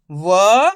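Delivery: व as one loud, held syllable; isolated, drawn-out "va" for व; letter sounded alone. Shown as a demonstration. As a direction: sound out व by itself, emphatically. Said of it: "किस तरीके से एक अक्षर का उच्चारण कर रहा हूँ".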